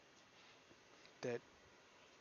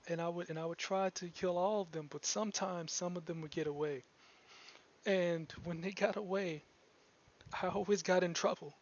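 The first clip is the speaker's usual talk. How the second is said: put-on young girl; 6 semitones higher, 4 dB louder than usual. NPC girl confesses past.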